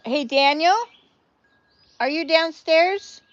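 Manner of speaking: high, loud holler with a questioning lift; calling out. Real, not acted. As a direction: call downstairs to someone. Calls to someone downstairs mid-joke.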